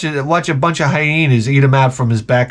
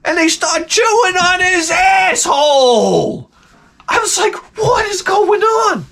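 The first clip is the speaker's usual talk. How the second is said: high shouting outrage, slowed; yelling in horror at hyenas attacking the wildebeest; horrified disbelief; 14 semitones higher, slower than usual. Real, not acted. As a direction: shriek in disgust at what you see.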